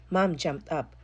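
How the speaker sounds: usual speaking voice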